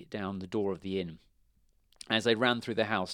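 usual speaking voice

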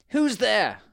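high, loud king's call; story king. A narrator's reading. King opens the door to a knock, high loud call. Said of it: "He opened the door and then said".

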